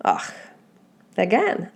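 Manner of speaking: normal talking voice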